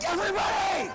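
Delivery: high, loud shout to the team. Retold or imitated. Calling the whole team over to the snake, shouted high. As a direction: yell for everyone to come now.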